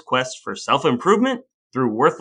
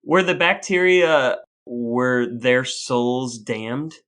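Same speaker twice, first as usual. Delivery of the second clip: slow mock-grave question; 3 semitones lower, much slower than usual. Joking mock-serious question.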